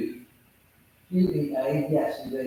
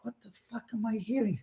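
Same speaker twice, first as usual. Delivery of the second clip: shocked aside; 9 semitones higher, 9 dB quieter, faster than usual. Retold or imitated. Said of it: "And I'm going".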